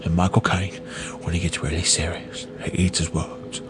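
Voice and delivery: hushed, low, serious Cockney impression; Michael Caine. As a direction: drop soft and low in a London accent. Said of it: "he talks like this".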